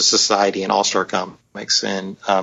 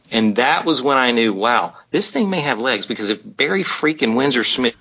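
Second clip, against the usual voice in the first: ordinary talk, nothing marked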